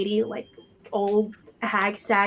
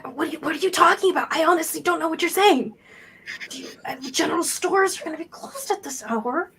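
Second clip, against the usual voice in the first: put-on higher child voice, dropping into a hush; wary, confused kid; 6 semitones higher than usual. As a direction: plead ignorance as a nervous kid. Game master plays the wary kid.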